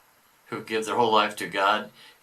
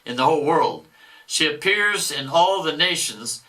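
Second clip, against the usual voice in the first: a boastful devil, louder than the commentary; 5 semitones higher, 4 dB louder, slower than usual. Acted. Devil boasting of stolen souls, mock devil voice. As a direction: read it as the gloating devil. Reader voicing the devil's message.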